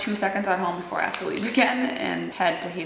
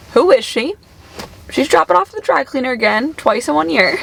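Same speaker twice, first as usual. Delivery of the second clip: higher, louder teasing voice; mock gossip; 7 semitones higher, 7 dB louder than usual. Teasing herself on camera for another dry-cleaner trip, mock gossip. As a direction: poke fun at yourself like a nosy onlooker.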